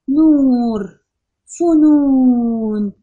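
نور and فنون loud, with فنون very slow and its oo held; loud, with the long oo drawn out; stretched long oo. Shown as a demonstration. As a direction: hold the oo as long as you can. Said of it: "أنا بطول وبمد في الحركة الطويلة".

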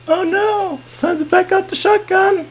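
very high, frantic cry; panicked squeal. Real, not acted. Gamer sees someone coming at him, panicked squeal. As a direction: squeal it as danger closes in.

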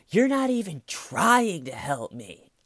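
high, slow, whining complaint; a put-upon wife's whine; whiny accusation. Retold or imitated.

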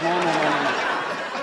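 mock doom warning with a laugh; slow deep refusal. (negación lenta y grave)

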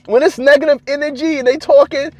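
ordinary talk, nothing marked

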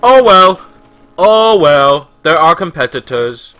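mock the shrugging company; mock dismissive company. Amazon refusing PayPal, loud careless mock voice. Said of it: "it's just like".

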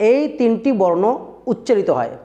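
ordinary delivery

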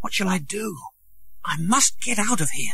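rising panic; worried boy. A narrator's reading. Tom afraid of being found, high and worried.

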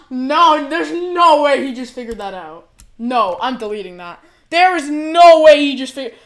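high, loud panicked outburst, voice cracking; shocked yelling. Real, not acted. Streamer yelling at chat for leaking his next level, high and loud. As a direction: scream in disbelief that chat found it out.

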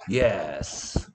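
낮게 깔아 느긋하게 대답하는 모범생 흉내; 여유 부리는 학생처럼 낮은 목소리로; 여유로운 모범생. Acted. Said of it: "모범생은 이렇게 대답하겠죠". (낮고 여유 있는 모범생 말투)

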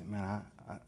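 low, subdued confession; down on his luck. Acted. Ex-prisoner answering what he does, low and downcast. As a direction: admit it softly and low. Role-play of a man down on luck.

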